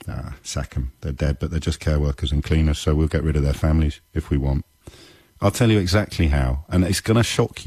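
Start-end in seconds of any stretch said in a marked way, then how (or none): 0.1-4.7 imitating an indifferent minister's passing thought; callous dismissal. Radio host voices the Home Secretary's thinking, callous dismissal. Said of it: "the Home Secretary can think".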